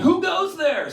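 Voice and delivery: high, prim "Who goes there?"; a high, fussy old-timey challenge; prissy challenge. Retold or imitated. Waking to a burglar, a high prim call. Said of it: "I said something bitchy".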